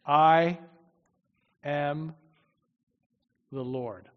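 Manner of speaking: I, am, the Lord, each word set apart by long pauses; slow pauses. Shown as a demonstration. Preacher spells out God's words for the congregation, each word separate. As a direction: let every word land alone: I... am... the Lord. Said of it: "he begins by saying".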